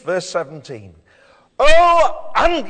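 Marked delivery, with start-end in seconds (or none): 1.6-2.7 loud, high outburst of anger; angry rebuke. Acted. Jesus rebukes his powerless disciples, angrily. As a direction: cry out in exasperated anger. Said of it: "you can hear the anger in his voice".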